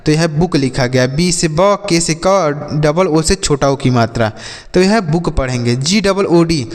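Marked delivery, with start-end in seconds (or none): none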